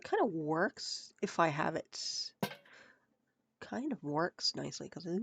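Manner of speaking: ordinary talk, nothing marked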